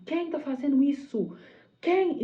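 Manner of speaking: high, loud imitated outburst; angry demand. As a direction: demand the culprit, rising on the last word. Angry leader's demand retold.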